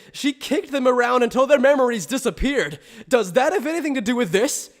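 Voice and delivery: high-pitched, flustered account; agitated questioning. Acted. Journalist recalling a scary incident to question Urahara, agitated. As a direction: keep it high and worked up.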